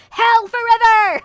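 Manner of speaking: high, loud mob shout; condemning shout. Acted. Imitating the one guy shouting at the sinner, condemning shout. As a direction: shout it like an accuser in a crowd. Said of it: "pointing and shouting".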